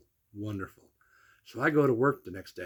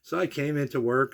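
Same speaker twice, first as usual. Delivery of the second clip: normal talking voice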